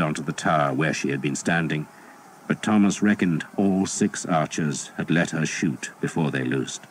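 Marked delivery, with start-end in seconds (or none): none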